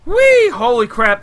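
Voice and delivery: high, loud gleeful whee; excited shout. Real, not acted. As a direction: squeal with delight. Launched by geysers in game.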